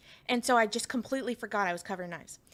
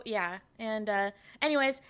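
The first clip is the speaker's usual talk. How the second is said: ordinary talk, nothing marked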